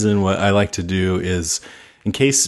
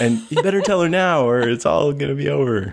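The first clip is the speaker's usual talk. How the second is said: high mock-urgent warning; mock urgency; 6 semitones higher than usual. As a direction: play it frantic and hammy. Mock urgent film dialogue.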